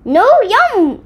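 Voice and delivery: high, loud bratty yell; a toddler shouting down the food; shouting baby. A narrator's reading. Baby refusing breakfast, shouting loudly. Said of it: "shouted Baby".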